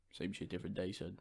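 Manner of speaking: low, soft fatherly reply; deadpan dad answering his son. Retold or imitated. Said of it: "And he was like".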